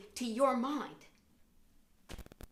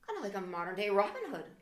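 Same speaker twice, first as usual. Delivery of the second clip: ordinary talk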